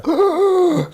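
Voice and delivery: high, sudden indrawn gasp; re-enacted death rattle gasp; imitated death gasp. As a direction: suck in air like coming back to life. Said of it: "the death roar, as they call it".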